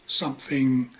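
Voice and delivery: usual speaking voice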